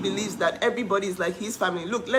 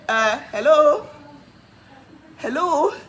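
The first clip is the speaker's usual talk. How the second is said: rising, incredulous hello, louder; sarcastic hello; 6 semitones higher, 5 dB louder, slower than usual. Real, not acted. Scoffing at online commenters, sarcastic rising hello. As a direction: toss back a mocking, disbelieving hello.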